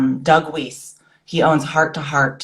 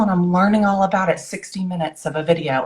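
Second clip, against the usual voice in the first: ordinary delivery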